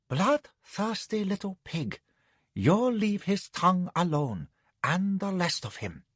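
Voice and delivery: softly yawned, languid concubine voice; yawning lady. Acted.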